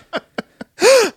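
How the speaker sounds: high dramatic mock gasp. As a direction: gasp high like a startled moviegoer. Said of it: "She was like".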